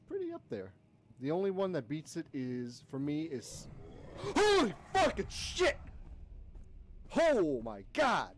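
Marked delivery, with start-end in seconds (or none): 4.2-8.3 high shocked yell, then drawn out; startled shout stretching into slow disbelief; scared outburst. Real, not acted. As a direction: cry out as a scare hits. That was legitimately scary.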